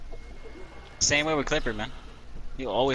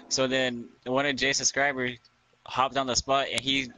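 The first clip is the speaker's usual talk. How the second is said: usual speaking voice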